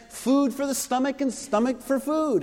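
lightly voiced scornful opponents; mocking quote. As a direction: toss off their slogan with a hint of mockery. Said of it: "They were saying".

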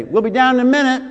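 put-on high, quick kid's reply; quick, high call back; kids calling back. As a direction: imitate kids putting off a parent.

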